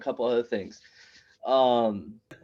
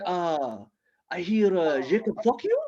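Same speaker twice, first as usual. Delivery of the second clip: put-on high, accented questioning with a sharp rise; scandalized foreign-accented friend; Ahmed; 6 semitones higher, slower than usual. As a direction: ask it haltingly in accented English, pitch climbing. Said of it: "kinda fresh off the boat".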